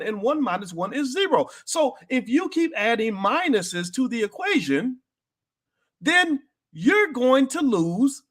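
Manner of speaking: high, slow sermon-style explanation; slow preaching. Real, not acted. Preacher explaining simple math to viewers, slow and high. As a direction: spell it out slowly like from the pulpit.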